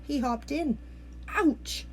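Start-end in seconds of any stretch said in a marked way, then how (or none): 1.3-1.8 croaking frog ouch. A narrator's reading. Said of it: "he croaked".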